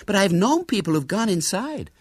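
high, insistent American lady; pleading persistence, pitched high; persistent. A narrator's reading. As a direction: keep pressing the point. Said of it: "persisted Miss Burford".

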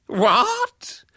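high, loud cry; horrified high-pitched outburst; aghast disbelief. A narrator's reading. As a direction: exclaim in appalled shock. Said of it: "clutching her head with both hands".

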